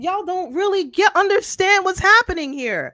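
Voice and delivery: exasperated disbelief, pitched high; flabbergasted. Real, not acted. Host tells co-hosts they don't understand, flabbergasted. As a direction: protest in high-pitched amazement. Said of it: "I am flabbergasted".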